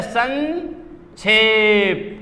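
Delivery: संक्षेप stretched very slowly, san... kshep; correct form demonstrated. Shown as a demonstration. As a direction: split संक्षेप into two long syllables. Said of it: "ये होता है संक्षेप".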